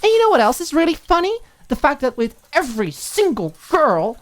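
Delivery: furious roommate ranting; angry tirade. Acted. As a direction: let the anger spill out. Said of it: "scrubbing at it furiously".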